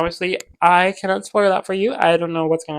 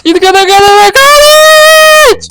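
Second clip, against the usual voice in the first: ecstatic shriek; 12 dB louder, slower than usual. Real, not acted.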